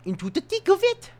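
high pitched sung-up phrase; squeaky repeat. Shown as a demonstration. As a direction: chirp it up high.

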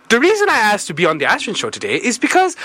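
usual speaking voice

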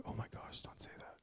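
hushed, scandalized whisper; mock the shocked churchgoer in a whisper. Preacher imitating people shocked by his talk, scandalized whisper. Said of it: "people are like".

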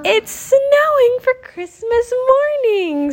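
very high, slow, amazed cry; overjoyed child. Acted. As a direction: marvel at the snow. Child delighted by snow.

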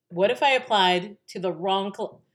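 mounting alarm. Retold or imitated. Daughter realizing a possible mistake, increasingly alarmed. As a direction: let the doubt grow into alarm. Second, rising take of the worry.

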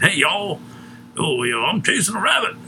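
a light, high-pitched mock Southern accent; Southern dialect. Mocking the old Splash Mountain ride, light Southern accent. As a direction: toss off a quick Southern twang, half-hearted. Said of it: "they had Southern dialect".

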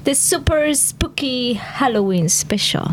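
slow intro in a put-on French accent; spooky show welcome, drawn out with a French accent. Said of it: "Can I speak with a French accent?".

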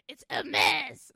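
put-on loud, haughty French accent; pretentious French. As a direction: blast it out as a snooty French snob. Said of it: "the most pretentious French bitch".

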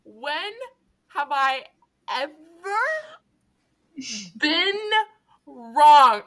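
When, have, I, ever, been, wrong with long pauses; slow emphatic pauses. Real, not acted. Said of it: "spaced word by word".

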